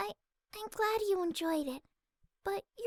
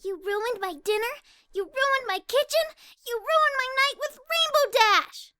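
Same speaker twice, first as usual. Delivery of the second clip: building louder and higher accusations; angry outcry; 5 semitones higher, 9 dB louder than usual. Acted. Fluttershy shouting at Angel for ruining her night. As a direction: cry out, anger rising with tears. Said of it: "Fluttershy cried out at her little rabbit friend".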